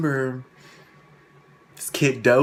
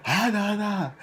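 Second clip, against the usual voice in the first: high-pitched mimicked wail; mocking fussing; 11 semitones higher than usual.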